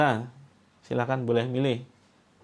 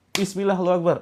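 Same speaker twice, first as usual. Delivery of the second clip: raised firm call; 6 semitones higher, 4 dB louder, faster than usual. Shown as a demonstration. Leader repeats the call for the group, firm and raised. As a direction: say it with conviction.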